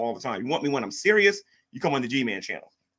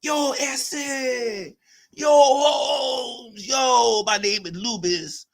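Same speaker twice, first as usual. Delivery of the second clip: high, hammed-up shouting rapper voice; overdone tough-guy yo; 8 semitones higher, much slower than usual. Streamer mocks a viewer's rap, hammed-up.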